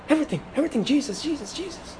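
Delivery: repeated softly, fading almost to nothing. Real, not acted. Pastor dwelling on Jesus' centrality.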